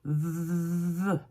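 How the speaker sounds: "The" with a long vibrating bee buzz on the th. Shown as a demonstration. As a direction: buzz like a bee before releasing "The". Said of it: "Imitez l'abeille".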